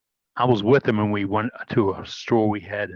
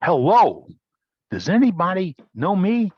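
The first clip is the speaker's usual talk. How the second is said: retold boisterous hello, a bit louder and higher; boisterous greeting; 7 semitones higher, 3 dB louder than usual. Boss announcing himself to ignoring store staff, boisterous mock call. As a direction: imitate the boss booming out to the staff. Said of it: "rather boisterous move".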